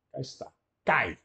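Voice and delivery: ordinary talk, nothing marked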